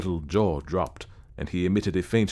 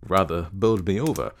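usual speaking voice

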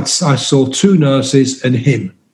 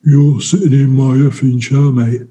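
a hint of a hulking, threatening inmate; lightly voiced brute demanding his seat; menacing inmate. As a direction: loom over him and claim your chair. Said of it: "leans over me".

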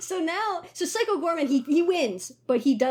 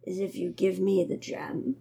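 low, slow creature plea; deep voice, dragging the words out; wounded alien warlord; 8 semitones lower than usual. Acted. Dying alien bargaining with girl for gem, low and slow. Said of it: "he's like".

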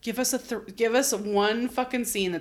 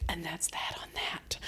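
much softer, voiceless whisper; 4 semitones lower, 15 dB quieter than usual. Pretend. Co-host answering the joke, still whispering. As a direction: keep it hushed like a secret.

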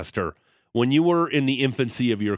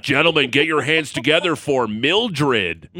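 loud, slow, higher club announcement; strip club DJ; 4 semitones higher, 5 dB louder, slower than usual. Pretend. Host's bar game with buddies, mock strip club DJ. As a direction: hype the next act, drawing out the words. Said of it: "being a strip club D J voice guy".

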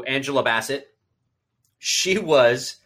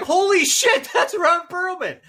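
shocked; 3 dB louder, slower than usual. Retold or imitated. Retold shocked recognition.

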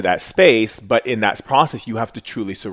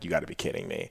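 stunned murmur; 5 semitones lower, 13 dB quieter, faster than usual. Retold or imitated. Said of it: "and I'm just like".

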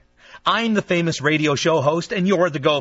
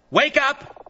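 calling out; 11 semitones higher than usual. Acted. Calling to silent caller.

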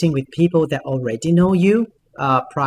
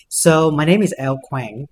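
normal talking voice

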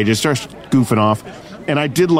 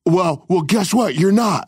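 higher-pitched combative comeback; mock retort; 7 semitones higher than usual. Guard putting down the comedian, mock retort. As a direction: imitate a guard hitting back.

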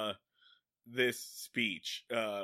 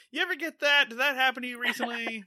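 mock-casual Tony; 10 semitones higher, 4 dB louder, faster than usual. Tony mock-casual questioning Bellows.

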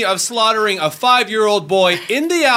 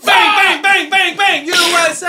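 shout; 10 semitones higher, 3 dB louder than usual. Acted.

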